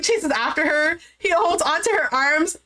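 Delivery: normal talking voice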